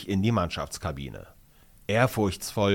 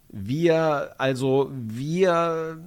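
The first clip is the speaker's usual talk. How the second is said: embarrassed stammer; 5 semitones higher, much slower than usual. A narrator's reading. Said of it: "Finn scharrt verlegen".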